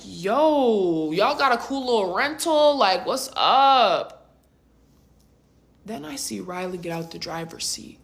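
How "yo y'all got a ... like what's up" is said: drawn-out, swooping hype greeting; hyped greeting. Retold or imitated. Friend hailing the couple in their Corvette, hyped. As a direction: stretch the words out, hyping up the friends.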